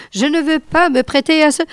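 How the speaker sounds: hurlé vite, fort et aigu; il hurle son refus en précipitant; hurlement. A narrator's reading. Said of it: "hurla-t-il encore". (hurlé, rapide et fort)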